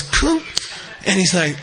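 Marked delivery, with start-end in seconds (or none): 0.0-0.4 sudden high-pitched pop; imitated mind blowing. Teacher mimics the man's mind going, high popping noise. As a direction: let out a little high sound effect. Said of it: "his mind goes".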